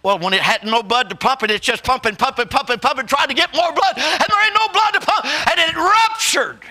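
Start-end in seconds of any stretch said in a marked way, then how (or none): 2.8-6.6 building higher and faster into a shout; rising preacher whoop. Acted. Preacher building to a high shout about the heart. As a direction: drive it up to the rupture.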